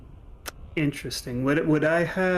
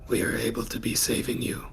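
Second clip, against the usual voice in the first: low, whispery voice with long pauses; solemn hushed adventurer; 4 semitones lower, 6 dB quieter than usual. Acted. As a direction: speak gravely in halting, hushed phrases.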